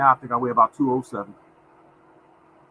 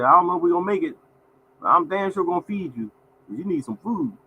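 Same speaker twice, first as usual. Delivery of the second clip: a higher teasing wife voice; 7 semitones higher than usual. Retold or imitated. Wife teasing her skinny date, higher playful voice. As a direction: tease him up high like his wife. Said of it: "She said".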